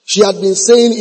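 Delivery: ordinary delivery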